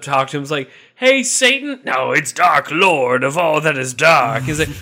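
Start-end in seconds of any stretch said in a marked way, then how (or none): 1.0-1.8 high, loud, eager greeting; a lowly demon chirping up to his boss; eager subdemon. Acted. Subdemon hails Satan, high and loud. 1.8-4.4 slow, loud, pompous voice; a devil insisting on his grand title; pompous Dark Lord. Acted. Satan corrects the demon, slow and loud pompous.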